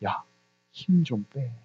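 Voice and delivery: low, soft, intimate word from God; God speaking to us. Acted. Preacher voicing God to believers, gently low and soft. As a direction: softly urge them, as God would, to ease off. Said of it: "하나님께서 우리에게".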